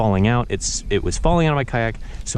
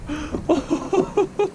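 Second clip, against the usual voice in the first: delighted laugh; 4 dB quieter than usual. Real, not acted. Laughing on catching fish.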